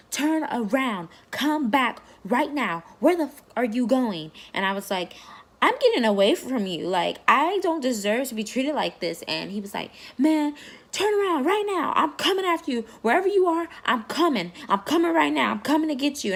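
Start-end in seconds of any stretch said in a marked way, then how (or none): 0.1-4.3 slow, loud, higher furious command; retold angry order. Husband on the phone demanding. 5.6-9.2 louder, higher reply pushing back; retold defiant yell. She answers him on phone. 10.2-16.3 much higher, loud furious threat; retold threatening scream. Husband threatens chasing her.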